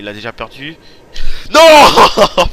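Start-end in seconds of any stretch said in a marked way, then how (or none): 1.5-2.5 cri plus aigu et plus fort; cri réel. Real, not acted. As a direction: hurler non en explosant. Explose dans la course. (cri de surprise en explosant)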